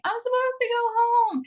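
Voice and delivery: high, wailing whimper; crying whine. Retold or imitated. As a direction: whimper it like a homesick crier. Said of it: "like, crying".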